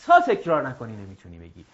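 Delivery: ordinary talk, nothing marked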